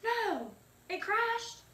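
real shock as it crashes; high dismayed outburst; dismayed cry. Real, not acted. Computer crashes mid-song.

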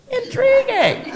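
high, drawn-out mock intrigue; slow, exaggerated wonder; mock fascination. Mock fascination at science titles.

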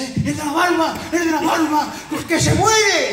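high, loud alarmed cry; alarma del médico. Retold or imitated. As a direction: shout it like a panicked doctor. Doctor alarmado llega corriendo. (grito alarmado del médico)

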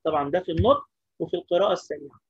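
usual speaking voice

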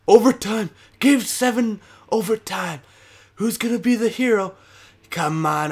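high, loud hyped call; excited. Real, not acted. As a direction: build the overtime hype, fast. Game goes to overtime.

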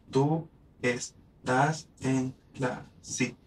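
very slow, split into syllables; slow demo reading. Shown as a demonstration. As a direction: spell out each syllable for counting. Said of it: "En vez de decir tú estás".

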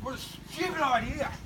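mouth burning from spicy food. Real, not acted.

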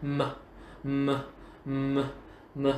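short hummed "M" like Vietnamese "mờ"; plain M sound. Shown as a demonstration. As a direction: repeat "M" as separate short lip hums. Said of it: "phát âm nó giống chữ M". (đọc âm "M" ngắn, tách từng tiếng)

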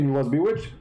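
ordinary talk, nothing marked

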